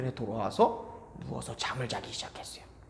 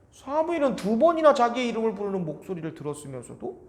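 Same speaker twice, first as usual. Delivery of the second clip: ordinary delivery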